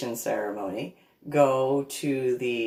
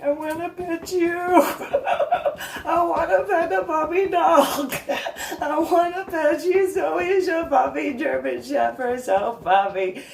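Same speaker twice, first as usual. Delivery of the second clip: high, slow giggly pleading; laughing baby talk; 5 dB louder than usual. Retold or imitated. To the dog, high laughing baby talk. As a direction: beg for the puppy through giggles.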